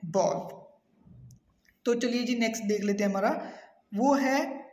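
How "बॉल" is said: "बॉल" as one long o vowel; corrected pronunciation. Shown as a demonstration. Teacher repeating the correct bowl, single vowel. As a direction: say "बॉल" slowly, no ow glide. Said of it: "इसे भी कहते हैं".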